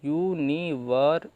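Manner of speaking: stretched out slowly; teacher sounding out the word slowly; slow demonstration. Shown as a demonstration. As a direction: spell the sounds out for students. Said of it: "इसका sound आ रहा है अर का".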